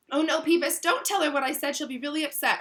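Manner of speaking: rushed, panicked begging; frantic. Acted. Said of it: "Hermione said frantically".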